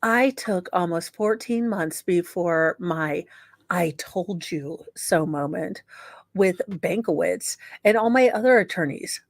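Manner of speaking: usual speaking voice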